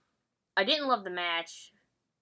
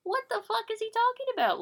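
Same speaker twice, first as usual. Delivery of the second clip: high, fast and baffled, in a put-on voice; imitating her own bewildered reaction at speed; baffled inner voice; faster than usual. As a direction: squeak out total confusion, much higher. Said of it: "and I'm like".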